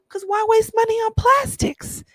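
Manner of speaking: why waste money, said sky-high; incredulous high question; high rhetorical question. Real, not acted. Rhetorical, pitch jumps very high.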